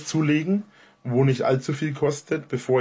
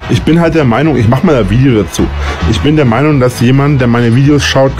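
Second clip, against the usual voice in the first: loud rant; 14 dB louder than usual. Real, not acted. Ranting loudly at critics.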